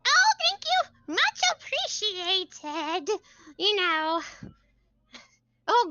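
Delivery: high, eager bookish woman; high chirpy NPC. Acted. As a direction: voice a flustered bubbly scholar. GM voices a book character.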